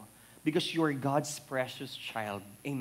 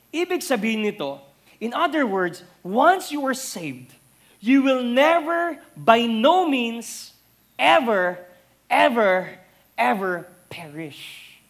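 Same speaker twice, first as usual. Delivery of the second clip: louder and higher, slow, hammering each ever; preacher crying out the assurance; building preaching climax; 7 semitones higher, 10 dB louder than usual. Acted. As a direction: climb to a shouted never, ever.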